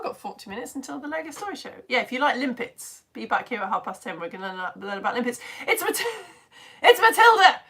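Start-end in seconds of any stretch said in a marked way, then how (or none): 5.7-7.6 high, loud, laughing cry of recognition; excited greeting. Real, not acted. Host spotting regular viewer Matilda, excited greeting. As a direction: burst out laughing with delight at the name.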